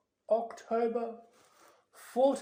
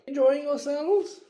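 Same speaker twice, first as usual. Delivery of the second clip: cheery host; 8 semitones higher, 10 dB louder than usual. Acted. Nick greeting guests cheerily.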